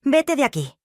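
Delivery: high, understated shout to leave; shouted order. A narrator's reading. Anguished woman orders the girl out of her room, mild shout. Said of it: "gritó".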